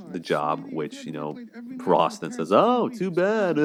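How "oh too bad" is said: high-pitched, slow, snide "too bad" with a laugh; jealous boyfriend's gloating jab at his girlfriend's friend; smug mock. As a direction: gloat like a petty rival, voice pitched way up. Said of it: "It's like, dude, come on".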